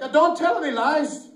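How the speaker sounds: high, insistent leprechaun. Acted. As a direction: play the little leprechaun driving a bargain. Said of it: "The leprechaun's like".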